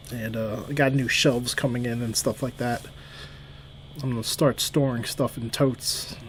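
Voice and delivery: usual speaking voice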